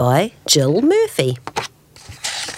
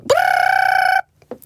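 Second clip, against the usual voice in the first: very high, sustained trill; ringing alarm clock. A narrator's reading. Alarm clock waking the bears, high trilled ringing. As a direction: ring like an alarm bell. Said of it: "went the alarm clock".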